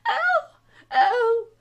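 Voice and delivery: two very high, loud shrieks; screaming. A narrator's reading. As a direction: shriek "oh" twice. Queen screaming in the story.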